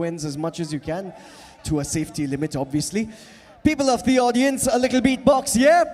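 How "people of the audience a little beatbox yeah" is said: loud call out to the crowd; rally the audience, shouting; crowd hype shout. Real, not acted. Performer shouting to the audience before a beatbox, loud hype.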